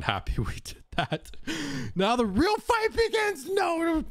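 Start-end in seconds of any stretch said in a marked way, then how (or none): 1.9-4.0 very high, strained mock alarm; mock-dramatic announcement. Streamer teasing chat about round three, mock-dramatic cry. Jokes the fight restarts.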